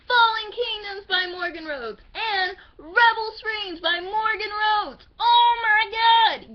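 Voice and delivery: high, loud fangirl squeal; psycho fangirl excitement. Real, not acted. Fan showing off favorite series to viewers, wildly excited squeal. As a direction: shriek it out, stretching the words in delight. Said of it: "I probably sound like I'm psycho right now".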